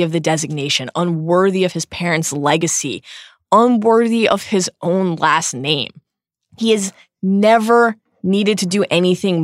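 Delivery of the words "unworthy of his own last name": ordinary talk, nothing marked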